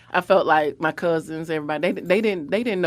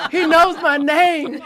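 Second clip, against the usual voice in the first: higher, louder slow laughing squeal; giddy laughing delight; 8 semitones higher, 5 dB louder, slower than usual. Retold or imitated. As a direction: laugh it out star-struck. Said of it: "And I was like".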